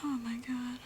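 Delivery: ordinary talk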